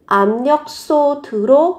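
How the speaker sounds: Ám nhóc xô thứ rô drawn out slowly, syllable by syllable; slow sound demo. Shown as a demonstration. Teacher slowly sounding out 압력솥으로 for students. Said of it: "biến đổi bờ thành m sẽ là àm".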